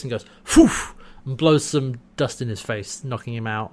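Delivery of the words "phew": imitated sharp puff of air; blowing puff. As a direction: blow out hard, as if blowing dust.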